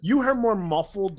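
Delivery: ordinary talk, nothing marked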